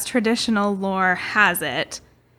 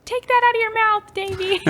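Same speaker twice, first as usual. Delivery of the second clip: high, sing-song scolding mother; scolding mom. Pretend. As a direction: call out to a naughty kid. Parent scolding a child Davy.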